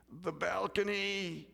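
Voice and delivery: soft, slow groaning answer; groaned out. Retold or imitated. Sprawled man answering the officer, groaning. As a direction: drag it out like a groan. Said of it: "he groans out the best that he possibly can".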